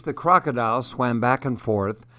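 plain reading voice

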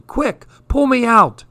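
put-on boy stuck in poo yelling for help; panicked yell. A narrator's reading. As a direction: shriek it like he's sinking.

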